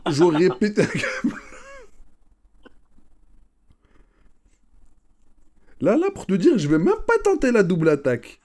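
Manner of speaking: loud, high laughing rant; excited laughing outburst. Real, not acted. (éclat de rire surexcité)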